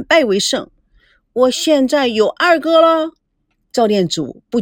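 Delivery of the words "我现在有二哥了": higher, playful little-sister voice; a girl's cheeky comeback, pitched up; gleeful girl. A narrator's reading. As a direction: crow it like a pleased girl. Cheeky girl claims victory.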